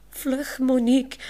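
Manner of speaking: a frightened urgent call; startled call. Acted. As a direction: shout it out in sudden alarm. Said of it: "roept Olaf met grote ogen van schrik".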